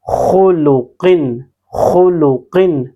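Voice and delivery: slow demo of خلقن with a thick, heavy خ, the mouth full; heavy full-mouth letter. Shown as a demonstration. Quran teacher showing students the heavy خ, slow and full-mouthed. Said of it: "کو کس طرح موٹا پڑھتے ہیں".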